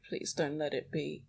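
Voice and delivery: ordinary delivery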